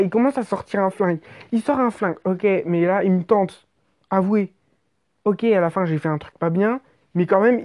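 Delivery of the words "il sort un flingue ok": usual speaking voice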